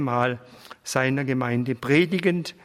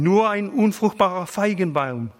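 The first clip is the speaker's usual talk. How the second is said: higher, faltering cry of a dying man; broken-off cry; 6 semitones higher than usual. A narrator's reading. Dying pastor laments himself, crying out in broken words. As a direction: call it out in broken pieces, lightly voiced. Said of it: "rief er in abgebrochenen Worten".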